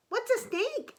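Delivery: squeaky little frog asking; high child frog voice. Acted. Young frogs asking father in a high childlike voice. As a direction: lift up high like a small child.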